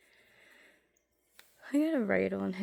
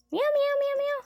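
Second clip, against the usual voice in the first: squeaky high mock meowing; a cat's meow, imitated high and loud; 8 dB louder than usual. Shown as a demonstration. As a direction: meow way up high like a kitten to coax her. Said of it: "say meow".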